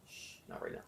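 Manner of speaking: soft, gentle hush to a passing thought; gentle shush. Shown as a demonstration. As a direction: shush your own thought kindly and softly. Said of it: "just gently say".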